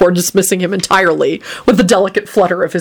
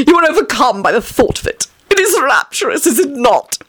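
rapturous; 7 semitones higher than usual. Acted. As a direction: gush it out in raptures. Vampire Tony's voice.

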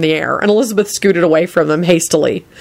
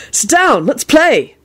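eager Neville; 8 semitones higher, slower than usual. A narrator's reading. Neville eager to start game.

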